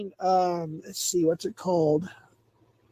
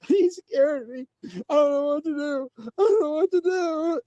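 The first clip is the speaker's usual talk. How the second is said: imitating a panicked man sobbing, pitched up; mock crying; 7 semitones higher than usual. Mocking a crying friend's call, in a whiny sob. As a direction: whimper it like a scared crybaby, rising into a wail. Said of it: "He was crying, tears, everything".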